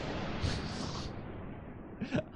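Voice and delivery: high airless chuckle; breathy laugh. Real, not acted. Storyteller amused by his messy room, airless laugh. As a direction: laugh at your own mess.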